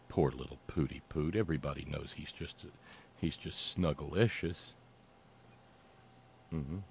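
put-on soft, low baby-talk coo; mock pitying coo. Host pretending to dote on Putin, mock tender coo. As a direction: coo it softly as if doting on a pet.

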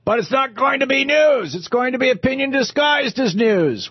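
high, hammed-up fake Australian; badly faked Aussie tycoon protesting; terrible Australian accent. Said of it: "I do a terrible Australian accent".

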